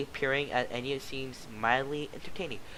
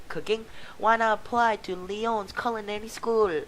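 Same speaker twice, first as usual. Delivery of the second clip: recruiter pitch; 8 semitones higher, 3 dB louder than usual. Acted. Booth pitch voice.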